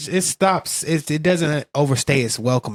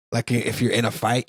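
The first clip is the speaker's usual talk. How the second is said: ordinary talk, nothing marked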